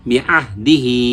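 বি আহ দি হি joined, last হি drawn out; short বি and দি, long final হি; joined recitation. Shown as a demonstration. Teacher modelling the word for students, বি আহ দি হি with stretched ending. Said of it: "আমরা উচ্চারণ কি করব".

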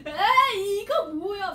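loud, high, laughing imitation of disgust; hammed-up yuck reaction; mock disgusted reaction. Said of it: "막 이러잖아요".

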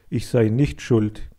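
ordinary delivery

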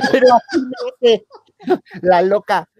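words squeezed out through laughter; laughing high. Real, not acted. Telling friends the story, laughing high. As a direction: laugh while imitating her.

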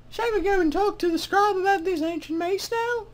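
high-pitched mock British woman; female-voiced British accent. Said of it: "that was, uh, horrible regional British accents".

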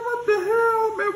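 very high, loud what the hell; incredulous. Retold or imitated. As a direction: squeal it in disbelief. Retelling his own reaction.

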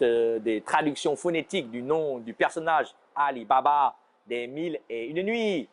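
usual speaking voice

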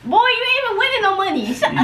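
high-pitched, laughing comeback; amused high squeal of objection; laughing protest. Real, not acted. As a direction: scoff up high through a laugh. Laughing protest at partner's boast.

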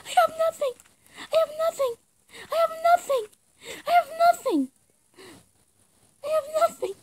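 high, crying whine; mock crying wail. Gamer wailing to teammates that she has no weapon. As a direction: whimper it like a tearful child.

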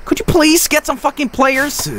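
high-pitched, frustrated demand; irritated, whining-high complaint; exasperated plea. Real, not acted. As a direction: beg the useless team for help. No players in position.

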